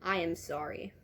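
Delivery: ordinary delivery, nothing marked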